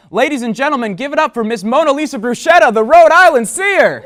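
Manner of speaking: loud, booming host intro; big showbiz welcome; announcer. Acted. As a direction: bring on the guest like a talk show host. Talk show host introduces guest.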